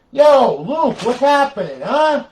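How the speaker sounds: loud greeting. Real, not acted. Calling out to arriving friend.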